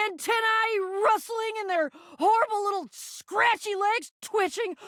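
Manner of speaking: high, frantic shrieking disgust; panicked revulsion. Acted. To Jacques on finding roaches everywhere, panicking. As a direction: freak out in a high, rattled voice.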